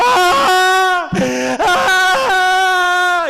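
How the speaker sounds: high, loud anguished wail; wailing cry. Acted. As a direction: let out a howl of grief. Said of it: "empieza a llorar". (grito de llanto agudo y fuerte)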